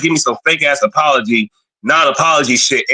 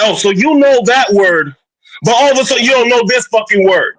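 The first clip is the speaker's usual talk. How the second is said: retold incredulous retort, higher voice; indignant comeback; 7 semitones higher than usual. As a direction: throw the hypocrisy back, pitch raised. Acted indignant comeback to the opponent.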